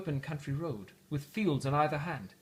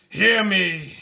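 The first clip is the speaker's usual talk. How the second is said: loud ghostly cry, raised pitch; cried out; 4 semitones higher, 12 dB louder than usual. Acted. Ghost demands Scrooge listen, loud cry. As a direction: call out to be heard. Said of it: "cried the ghost".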